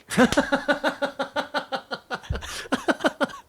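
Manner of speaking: long high burst of laughter; big laugh. Real, not acted. Narrator cracking up at his own story. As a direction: break up laughing.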